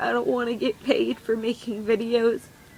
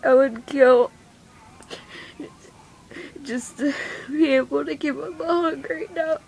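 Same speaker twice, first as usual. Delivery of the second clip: crying; 3 semitones higher than usual. Real, not acted. Tearful teen mom telling viewers he was taken away, crying. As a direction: voice climbing through real tears. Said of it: "I'm sorry I'm crying".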